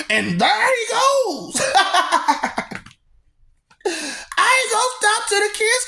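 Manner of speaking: high laughing slowed cry; hammed-up clown announcement; laughing shout. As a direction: whoop it out through laughter.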